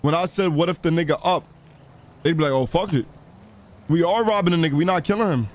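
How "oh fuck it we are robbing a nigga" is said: usual speaking voice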